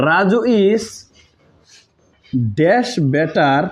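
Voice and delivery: each word drawn out very slowly; slow dictation. Shown as a demonstration. Teacher slowly dictating the blank in a sentence. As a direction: spell it out slowly for students to copy.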